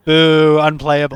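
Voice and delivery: loud playful boo; mock booing. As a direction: jeer it like a heckler.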